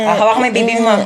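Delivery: ordinary talk, nothing marked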